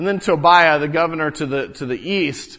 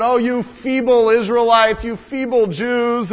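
higher, louder sneering jeer; mocking taunt; 6 semitones higher, 5 dB louder than usual. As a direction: scorn them as weaklings. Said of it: "he starts taunting us with that".

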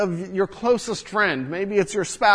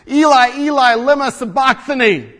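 loud cry; 6 semitones higher, 6 dB louder than usual. Retold or imitated. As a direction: cry out Eli, Eli, lema sabachthani louder and higher. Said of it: "cried out in a loud voice".

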